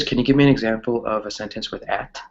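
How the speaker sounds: usual speaking voice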